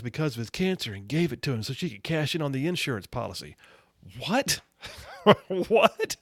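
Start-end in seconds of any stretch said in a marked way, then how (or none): 4.3-6.2 a high, incredulous double take into a laugh; can't believe what he just read; stunned disbelief. Real, not acted. Radio host reacting to the robocall story, incredulous.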